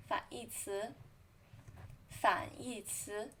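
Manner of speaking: 反义词, 反 in a falling-rising third tone. Shown as a demonstration. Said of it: "Remember, this is the third tone".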